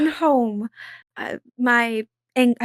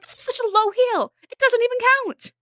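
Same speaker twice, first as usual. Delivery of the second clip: much higher, protesting lady voice; indignant Izzy; 12 semitones higher than usual. Acted. Izzy to Willa, showing her heels, high prim protest. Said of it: "she's like".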